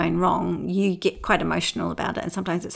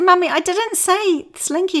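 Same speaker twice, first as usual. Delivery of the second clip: put-on high, slower, louder child voice; patient child correction; 10 semitones higher, 6 dB louder, slower than usual. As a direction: set the record straight like a little girl. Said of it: "she said".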